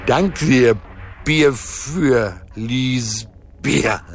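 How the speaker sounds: slow, high, tipsy delight; gleeful drunken chortle. A narrator's reading. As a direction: draw the words out, happily drunk. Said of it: "gluckste der Dreiecksgott vergnügt". (vergnügt glucksend, betrunken gedehnt)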